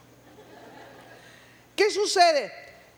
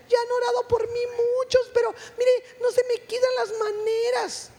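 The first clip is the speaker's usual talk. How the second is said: very high, plaintive little voice; effeminate little voice; 11 semitones higher than usual. Retold or imitated. Preacher imitating the man saying prayers failed him, high little effeminate voice. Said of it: "con una vocecita".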